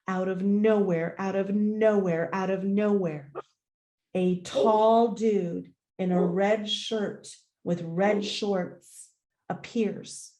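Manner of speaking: ordinary delivery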